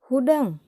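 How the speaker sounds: "hudang." barked high and loud with a falling end; command tone. Shown as a demonstration. Teacher demonstrating a command to pupils, loud and sharp. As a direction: snap out "hudang." as a sharp order. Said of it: "hudang itu kalimah parentah".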